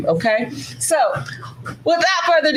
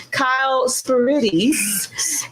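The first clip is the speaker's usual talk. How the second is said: "Spiridis" slow and carefully drawn out; careful pronunciation; much slower than usual. Real, not acted. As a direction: announce "Kyle Spiridis" slowly, taking care with the name. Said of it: "I didn't want to mess up his name".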